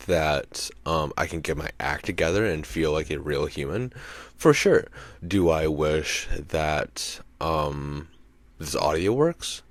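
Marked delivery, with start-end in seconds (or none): 4.4-4.9 much higher and louder, with emphasis; emphatic answer. Real, not acted. To his own rhetorical question, an emphatic much higher and louder answer. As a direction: fire back the answer with gusto.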